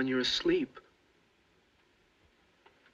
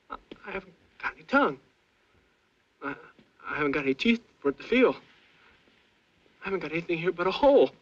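fast, rising panic; panicked; 4 semitones higher, 7 dB louder, faster than usual. Acted. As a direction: blurt it out in growing panic.

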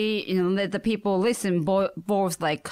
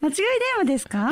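higher, very fast polite phone question; 9 semitones higher, much faster than usual. Shown as a demonstration. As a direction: rattle it off politely. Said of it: "do your phone voice".

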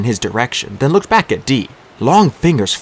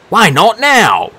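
slow, loud, higher demand; 11 semitones higher, 6 dB louder, much slower than usual. A narrator's reading. Dee demanding of the Disir why they wait. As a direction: press them hard for an answer. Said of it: "Dee demanded".

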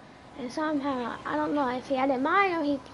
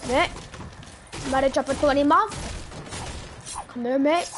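put-on Australian accent. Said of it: "Do your, do your Australian joint voice".

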